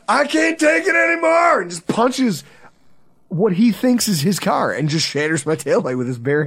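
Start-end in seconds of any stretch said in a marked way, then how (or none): 0.1-1.6 high, loud, desperate shout; a fan's tormented cry of despair; anguished yell. Retold or imitated. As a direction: yell it like you've snapped. Drunk friend punches taillight.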